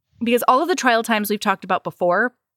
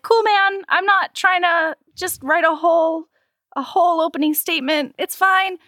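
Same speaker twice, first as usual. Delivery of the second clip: sarcastic high quote; 8 semitones higher than usual. Retold or imitated. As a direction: toss it off with fake breeziness. Voicing character's sarcastic reply.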